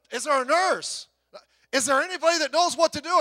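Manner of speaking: loud, high call to the crowd; desperate call for help. Acted. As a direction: shout out searching for anyone who can help. Crowd calling out in joke.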